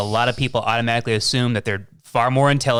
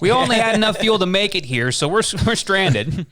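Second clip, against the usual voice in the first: mock pleading alien; 7 semitones higher than usual. Aliens acted out.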